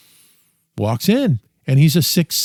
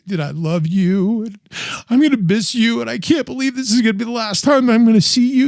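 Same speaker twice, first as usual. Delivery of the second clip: higher, choked farewell words to a father; tender goodbye; 7 semitones higher than usual. Retold or imitated. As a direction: say goodbye, holding back tears. Last goodbye to dying dad.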